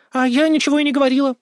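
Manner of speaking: put-on high, quick girlish protest; feigned innocence. A narrator's reading. Daughter caught by father, innocent denial. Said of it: "невинно заявила Тиа".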